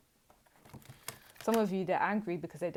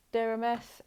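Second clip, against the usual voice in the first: louder repeat; 6 semitones higher, 7 dB louder than usual. Shown as a demonstration. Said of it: "repeats louder".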